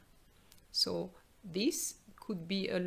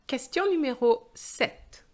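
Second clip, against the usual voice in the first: higher, louder exam announcer; loud question read-out; 10 semitones higher, 9 dB louder than usual. Shown as a demonstration. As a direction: announce the next question boldly. Reads exam question aloud.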